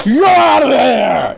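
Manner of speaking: mock umpire yelling the out; umpire call. Gamer mimicking the umpire's out call, loud mock yell. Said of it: "He's like".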